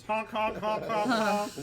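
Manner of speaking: high, loud horn honks; silly clown. Acted. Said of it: "pulls a little horn ... and goes".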